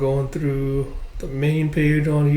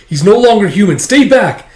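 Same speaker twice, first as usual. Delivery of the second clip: high, loud, forceful warning; shouted warning; 8 semitones higher, 8 dB louder than usual. Acted. General holds boy back from dragon, shouted. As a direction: order him back at full voice.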